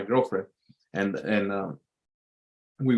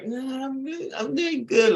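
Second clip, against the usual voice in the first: high, reluctant mock reply; uncomfortable girlfriend; 12 semitones higher than usual. Girlfriend answering the creep, high awkward mock reply. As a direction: play her forced politeness. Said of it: "like, with that face".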